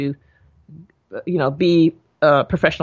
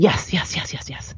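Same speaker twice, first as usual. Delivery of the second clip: eager whispered yeses; 2 semitones lower, 4 dB quieter than usual. Retold or imitated. Eager daughter answering father.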